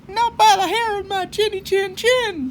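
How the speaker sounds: very high, loud squeal of a little pig; high piggy shout; shouting little javelina. Acted. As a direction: defy the coyote in a squeaky pig voice. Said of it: "shouted the first javelina".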